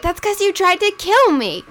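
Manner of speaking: high-pitched retold comeback; mock indignant retort. She answers his claim that he saved her, mock indignant. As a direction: throw it back as a hammed-up accusation. Retold quarrel between two characters.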